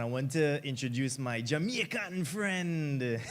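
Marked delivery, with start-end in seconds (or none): 1.4-3.2 slow, joking Jamaican accent put on with a laugh; a playful, clumsy Jamaican accent; bad Jamaican accent. Host introducing Jamaican friend in bad Jamaican accent. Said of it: "that was a bad accent".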